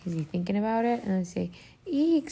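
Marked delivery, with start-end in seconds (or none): 1.9-2.3 small storybook scream. A narrator's reading. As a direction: squeal lightly like startled trick-or-treaters. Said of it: "screamed some trick-or-treaters".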